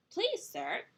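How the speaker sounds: high-pitched polite little pig; first little pig. Acted. Little pig asks the man for straw, high polite plea. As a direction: plead sweetly, pitched well up. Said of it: "the first little pig said to the man".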